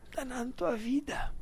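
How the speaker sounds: breathy whispered repeat; whispering. Real, not acted. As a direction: drop to a whisper.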